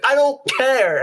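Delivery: high-pitched mock outburst; imitated yell. Teammate yelling for his viewers, mock high-pitched outburst. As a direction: toss it out high, without much extra volume. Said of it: "wants the viewers to see him yell".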